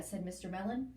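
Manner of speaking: ordinary delivery, nothing marked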